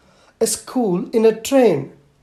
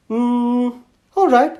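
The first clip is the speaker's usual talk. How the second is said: put-on higher child voice, a pondering hmm then a bright all right; thoughtful little girl; 6 semitones higher, 4 dB louder, slower than usual. Little girl agreeing to a new school, thoughtful then bright. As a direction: think it over, then agree like a small girl.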